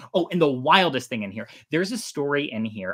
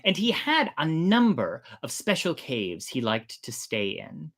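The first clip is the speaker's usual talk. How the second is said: story narration. Acted. Host beginning the shark god chapter for listeners, storyteller voice. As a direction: read it like a myth told aloud.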